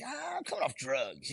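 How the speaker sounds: high-pitched, strained mock plea; retold protest. Patient answering hospital staff who called him crazy, high-pitched protest. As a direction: protest it high up, stretching the opening cry.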